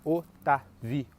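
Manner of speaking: "Otávio" pausado: o, tá, vi, o; quatro sílabas separadas. Shown as a demonstration. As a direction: quebrar o final de "Otávio" em VI e O. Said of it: "a divisão silábica seja". (o... tá... vi... o, bem separado)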